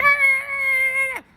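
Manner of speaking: high squealing mock yell of a kid; a shrill bratty shriek; student's angry outburst. Fresh student snapping back at teacher, high mock shriek. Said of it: "He's like".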